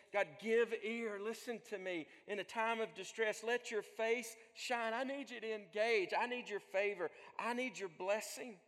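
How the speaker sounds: loud, high, insistent cry to God; impassioned prayer cried out in distress; distressed plea. Acted. Psalmist's distressed plea, acted.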